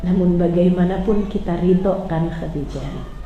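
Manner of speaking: soft, low gentle comfort; tender consoling. Acted. Prophet gently comforts grieving Khadijah, soft and low. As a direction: console her quietly.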